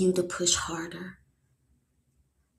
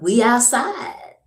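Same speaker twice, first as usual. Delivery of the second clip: loud, raised, rising pitch; high, loud comic aside before laughing; playful exclamation; 6 semitones higher, 8 dB louder than usual. Real, not acted. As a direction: belt it out playfully, climbing at the end. Playful aside about being out.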